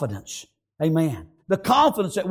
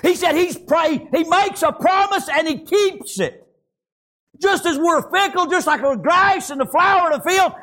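impassioned, raised sermon delivery; fervent preaching; 7 semitones higher than usual. Real, not acted. Preacher telling the congregation God never lets you down, fervent preaching. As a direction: drive each phrase home to the congregation.

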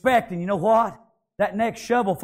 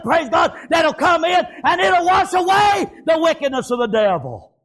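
high, driving revival preacher; high-pitched pulpit shouting; full-force preaching; 6 semitones higher than usual. Real, not acted. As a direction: preach it hard, pitched well up.

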